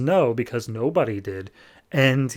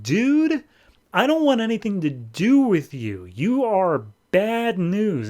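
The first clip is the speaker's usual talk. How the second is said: exasperated Jonah; 8 semitones higher, slower than usual. Retold or imitated. Jonah voiced rejecting Gordon.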